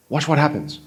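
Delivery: ordinary delivery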